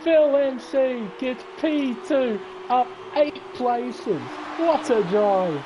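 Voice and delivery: high, slow, drawn-out race call; triumphant commentary. Real, not acted. Commentator praising a climb of eight places, triumphant. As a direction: savour every word of the big result. Celebrates driver's surprise result.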